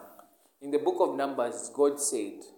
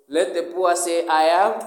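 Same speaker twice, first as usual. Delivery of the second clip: loud, raised declaration of the verse; proclaiming loudly; 5 semitones higher, 12 dB louder than usual. Acted. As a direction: call it out to the congregation. Preacher proclaiming another scripture.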